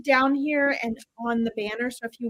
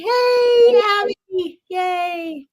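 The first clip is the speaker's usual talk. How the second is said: much higher and louder, excited hello; excited greeting; 7 semitones higher, 4 dB louder, much slower than usual. Real, not acted. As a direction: greet her with a happy squeal. Second greeting to same viewer.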